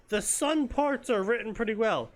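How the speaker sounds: ordinary talk, nothing marked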